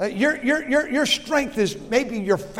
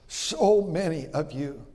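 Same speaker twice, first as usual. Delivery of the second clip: usual speaking voice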